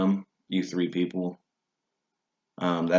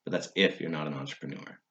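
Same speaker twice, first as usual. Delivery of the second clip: ordinary talk, nothing marked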